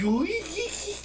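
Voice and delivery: a high-pitched burst of laughter; high squealing laugh. Real, not acted. Laughing at the groom, a high squeal.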